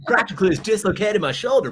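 high, loud bro voice through laughter; mock-shocked bro. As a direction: play the punched bro, hammed-up and laughing. Joking as the punched guy.

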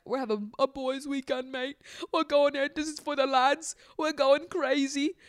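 high mocking lad voice with British accent. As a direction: sneer it out like a laddish British bloke. Mocking Jax's lad talk.

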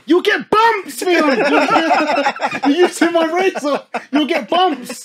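high, loud laughing scolding mum. Retold or imitated. Imitating mum warning him about bumps, high and loud. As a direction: warn him like a fussing mother. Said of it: "She said".

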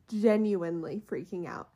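ordinary delivery

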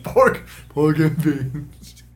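very slow, drawn-out grunting drawl; Sling Blade impression. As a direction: stretch it like a slow backwoods man. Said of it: "Listen, Sling Blade".

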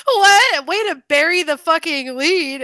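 imitating her own stunned reply; shocked retort. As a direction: snap back in mock outrage. Reacting to buried news.

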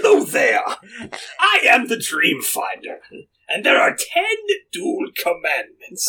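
a hint of an announcer; Dream Finder voice. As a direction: greet them like a cheery ride host. Voicing the Dream Finder character.

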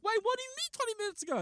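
very high, fast mock disbelief; squeaky rushed retort; incredulous question. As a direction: rattle it out high in exasperation. Repeats exasperated detective question.

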